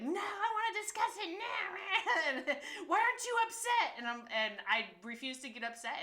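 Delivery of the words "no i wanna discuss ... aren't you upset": high, loud, laughing mock-whine of the family; mimicking family member. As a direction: squeal it like the upset spouse egging you on.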